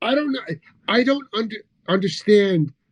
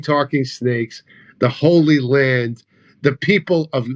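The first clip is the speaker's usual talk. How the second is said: ordinary talk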